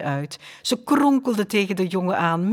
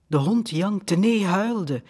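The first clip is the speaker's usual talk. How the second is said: plain reading voice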